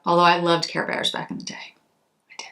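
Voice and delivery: a confiding whisper; whispered aside. Real, not acted. To her viewers, admitting a childhood love in a whisper. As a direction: whisper it like a little secret.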